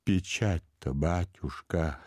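slow, mildly pleading servant; plaintive. A narrator's reading. Servant timidly raises the seal with master, plaintive. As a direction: draw it out in timid complaint. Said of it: "жалобно начал Иона".

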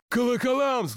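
high-pitched eager call; shouting from crowd. A narrator's reading. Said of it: "закричал из толпы Никита Псов".

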